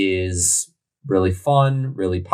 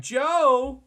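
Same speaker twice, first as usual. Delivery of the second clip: squeaky call; 14 semitones higher, 3 dB quieter than usual. Acted. Sudden joking call.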